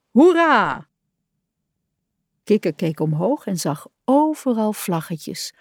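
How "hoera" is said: a loud, high hooray; hurrah cheer. A narrator's reading. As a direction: cheer it out in a crowd. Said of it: "riepen ze".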